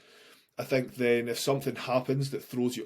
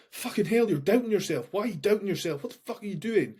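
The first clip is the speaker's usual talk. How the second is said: high, louder, exasperated inner voice; exasperated self-scolding; 8 semitones higher, 4 dB louder, faster than usual. Retold or imitated. Anxious man scolding himself in his head, high exasperated outburst. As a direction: snap at yourself for doubting.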